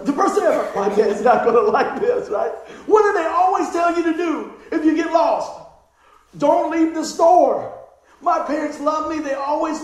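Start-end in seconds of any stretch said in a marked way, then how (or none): 0.7-2.3 put-on louder, worried kid thought; mock alarm of a lost kid; loud panic. Child's panic retold. 6.4-7.8 slow and loud, each word hit; preacher's emphatic warning; emphatic rule. Retold or imitated.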